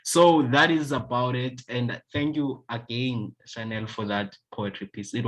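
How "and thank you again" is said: usual speaking voice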